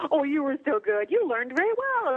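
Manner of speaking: put-on high, sweet praise as to a child; patronizing praise. Gods pat humans on the head, patronizingly sweet. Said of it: "pat us on the head and go".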